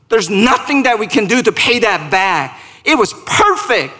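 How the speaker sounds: high, driving pulpit delivery; full-force gospel proclamation; impassioned preaching. Real, not acted. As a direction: proclaim it with rising fervour, punching key words.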